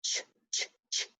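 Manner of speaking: a voiceless, repeated "Ch, ch, ch" with a crisp burst; digraph sound. Shown as a demonstration. Teacher sounding out the digraph for second graders, voiceless "Ch". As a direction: tap out "Ch" as the digraph sound, three times. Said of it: "Digraph C H".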